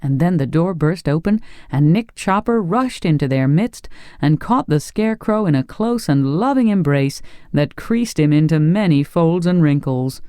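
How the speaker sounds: usual speaking voice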